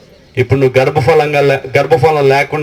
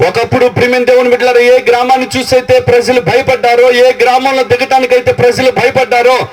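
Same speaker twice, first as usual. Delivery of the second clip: full-force preaching; 8 semitones higher than usual. Acted. Pastor recounting the feared village, full-force preaching. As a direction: drive the story of the village home.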